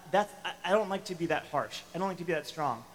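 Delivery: ordinary talk, nothing marked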